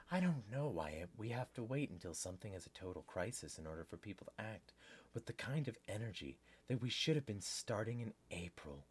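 low, very soft reading voice; article read-aloud. Real, not acted. Host reads news article to viewers, quiet read-aloud.

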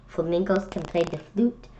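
plain reading voice

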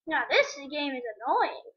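usual speaking voice

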